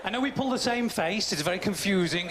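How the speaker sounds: ordinary talk, nothing marked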